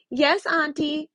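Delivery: cat fakes Masha's voice answering Baba Yaga; cat imitating Masha. Squeaky cat mimicking a girl. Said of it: "trying to talk like Masha".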